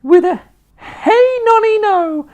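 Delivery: very high, very loud screechy crone whoop; wild old woman's shout. A narrator's reading. Grandma rising through the floor, screeching with glee. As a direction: whoop with mad joy. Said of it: "she shouted".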